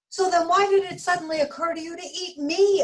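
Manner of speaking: high, loud indignant demand. A narrator's reading. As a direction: demand an answer, outraged. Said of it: "Arnie demanded".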